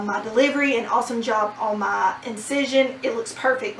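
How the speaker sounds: ordinary talk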